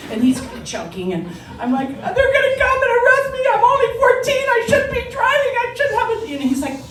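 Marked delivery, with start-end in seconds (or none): 1.9-6.3 very high, loud panicked wail; hysterical blubbering. Retold or imitated. Teen to instructor after hitting picnic table, hysterical. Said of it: "blubbering, I'm hysterical".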